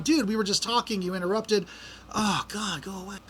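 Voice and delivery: quiet, fed-up muttering that fades to almost nothing; grumbling under breath. Retold or imitated. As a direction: mutter it to yourself, annoyed. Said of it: "I'm sitting here going".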